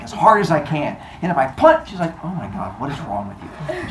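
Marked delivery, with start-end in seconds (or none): 2.2-3.9 low, soft, despairing aside; acted weary girlfriend, under the breath; quietly appalled at his stupidity; muttered exasperation. Girlfriend exasperated at foolish plan.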